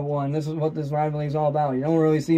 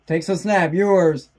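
normal talking voice

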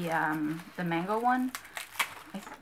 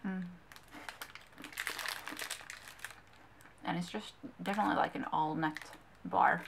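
speaking between bites; chewing while talking; 3 dB quieter than usual. Real, not acted. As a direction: mouth partly full of food. Seen 5: Bites bar at -2; closed-mouth chewing while holding bar throughout.

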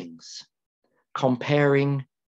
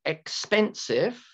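EXPENSIVE said slowly, higher, ending on a rise; slow example word; 6 semitones higher, slower than usual. Shown as a demonstration. Teacher asking students to count syllables, slow rising EXPENSIVE. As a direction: offer EXPENSIVE slowly as a question. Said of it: "how many syllables?".

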